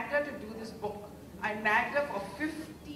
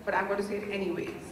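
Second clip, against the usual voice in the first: rushed gushing; much faster than usual. Real, not acted. Gushing rush after applause.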